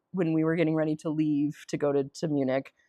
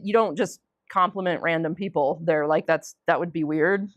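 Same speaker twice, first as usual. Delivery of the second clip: normal talking voice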